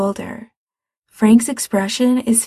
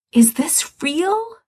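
awestruck; 8 semitones higher, slower than usual. A narrator's reading. Ray awed at the sky.

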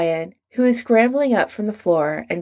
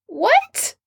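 much higher shocked squeak. A narrator's reading. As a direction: squeak it out in disbelief. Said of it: "he squeaks".

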